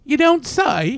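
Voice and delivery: high-pitched mock British nanny; hammed-up high British voice; Mary Poppins. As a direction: put on a prim British accent. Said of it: "that was my Mary, Mary Poppins".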